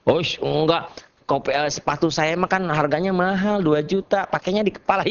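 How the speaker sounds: hammed-up objection; mock objection. Acts out an objector.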